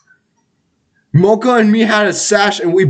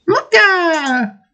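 MOCHA in a very high, drawn-out falling squeal. Shown as a demonstration. Gamer showing friends how to say the name, high squeal. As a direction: squeal MOCHA and hold the last vowel. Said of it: "you have to say it like that".

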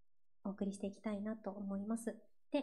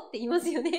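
ordinary talk

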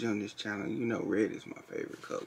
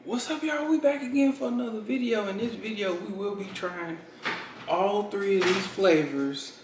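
high, bright youtuber intro; vlog intro; 8 semitones higher, 4 dB louder than usual. Acted. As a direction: start the video with energy.

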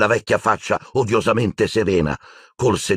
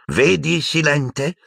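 higher, sly teasing; mischievous Phineas Nigellus; 9 semitones higher, much slower than usual. A narrator's reading. Old portrait taunting the headmaster, sly and higher. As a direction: needle him with a smirk. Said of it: "disse Phineas Nigellus malizioso".

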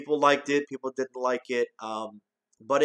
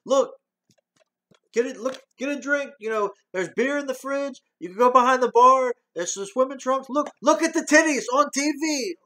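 much higher, put-on voice, bursts louder; imitating Axel as host; 11 semitones higher, 4 dB louder than usual. Reviewer acting out Axel taking over the house, high pitched. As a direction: grandly show the guests around. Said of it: "He takes over this house".

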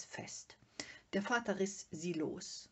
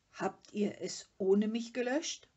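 indignant question; 4 semitones higher, 8 dB louder than usual. A narrator's reading. Father offended at being left out.

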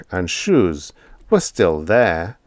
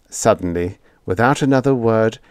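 ordinary delivery, nothing marked

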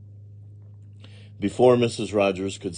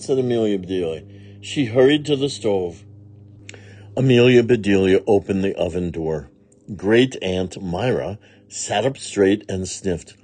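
ordinary delivery

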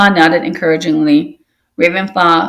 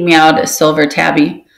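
plain reading voice

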